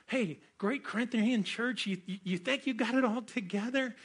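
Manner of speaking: playfully mocking Paul; teasing. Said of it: "messing with them right now, going".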